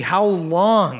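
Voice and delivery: high, aching plea; pleading. Acted. Preacher repeating the psalm's plea to God. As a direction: ask it as a longing lament. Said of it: "That's the plea".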